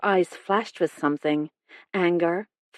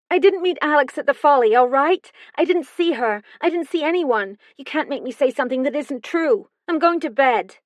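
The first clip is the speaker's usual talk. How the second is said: much higher and louder, fast, rising in fury; outburst; 8 semitones higher, 4 dB louder, faster than usual. A narrator's reading. Daughter leaping up to shout at the detective. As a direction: shout the denial as she storms off.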